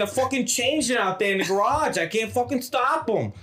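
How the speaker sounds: high, urgent mock Boston voice; hammed-up Boston tough guy; Mark Wahlberg. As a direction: panic in a put-on movie-star voice. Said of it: "They got Mark Wahlberg".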